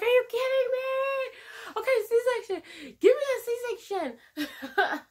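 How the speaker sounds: high, slow, whiny mock desperation, with a laugh; desperate plea. Retold desperate demand, laughing.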